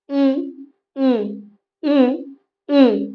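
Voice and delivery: ई repeated, each held apart from the palate; vowel demo. Shown as a demonstration. Teacher showing students the palatal ई, deliberate. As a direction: sound ई again and again for the class to copy. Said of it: "जैसे बोल के देखिए आप".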